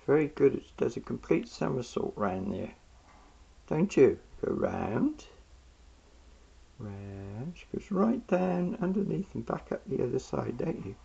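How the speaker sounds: ordinary talk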